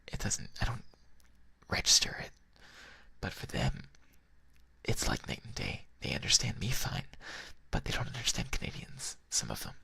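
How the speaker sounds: breathy, near-whispered relaxed talk; sleepy, whispered ASMR-style chat; hushed whispering. Real, not acted. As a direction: murmur it close, with long pauses. Quiet, rambling whispered talk.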